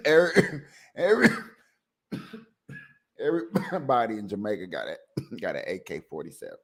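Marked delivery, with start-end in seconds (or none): none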